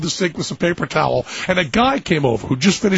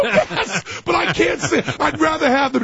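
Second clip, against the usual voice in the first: much higher, helpless pleading; plead, voice pitched way up; a pitched-up, helpless plea; 9 semitones higher than usual. Retold or imitated. Helpless reply to stranger.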